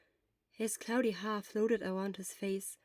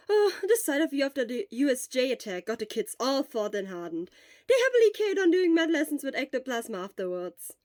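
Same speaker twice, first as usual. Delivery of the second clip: lively, louder visiting friend; Hisashi's voice; 4 semitones higher, 8 dB louder than usual. Acted. Hisashi brightly telling sick Shota about the class, louder. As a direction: tell the news brightly and loudly.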